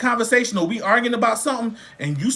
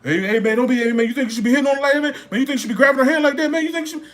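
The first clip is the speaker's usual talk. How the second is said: higher, quick mock of a meddler; hammed-up busybody stepping in, higher and fast; a mock do-gooder, higher and rushed; 4 semitones higher, much faster than usual. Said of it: "trying to play Captain Save 'em".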